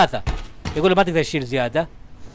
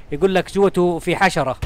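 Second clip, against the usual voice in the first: usual speaking voice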